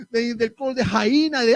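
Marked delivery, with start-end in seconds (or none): none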